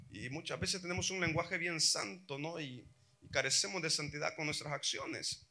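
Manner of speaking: ordinary delivery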